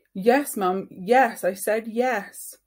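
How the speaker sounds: exasperated. Acted. Boy exasperated by mum.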